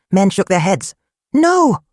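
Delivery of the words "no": high, loud scream of no; a stepmother's shocked cry. A narrator's reading. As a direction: stepmother screams at the wedding news. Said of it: "Adana's stepmother screamed".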